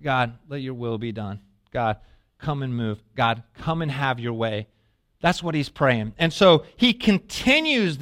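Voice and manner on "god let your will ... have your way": soft, low, earnest prayer to God; hushed prayer. Retold or imitated. Jesus praying at the other side of the Jordan, softly. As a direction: pray it softly, lower and quieter. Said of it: "he's praying the whole time".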